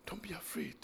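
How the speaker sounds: breathy whispered plea; softly whispered reassurance. Real, not acted. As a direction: whisper it like a secret comfort. Emotional hush in sermon.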